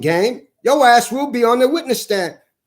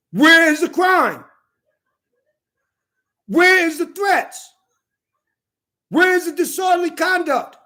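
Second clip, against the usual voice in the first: high-pitched, indignant shouting; outraged demand; 5 semitones higher than usual. Real, not acted. Man demanding to know his crime, outraged demand. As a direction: challenge the accusers, drawing out the questions.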